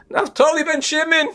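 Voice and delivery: very high, tearful cry of a name. Retold or imitated. Weeping father names his third son to the Gabbai, crying. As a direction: cry out the son's name, imitating a sobbing father. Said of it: "he's crying".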